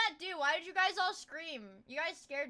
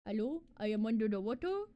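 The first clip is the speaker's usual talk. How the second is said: muffled, lower voice as if drowning underwater; muffled under-water meme voice; 7 semitones lower, 4 dB quieter, faster than usual. Pretend. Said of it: "sounds like you're in a closet".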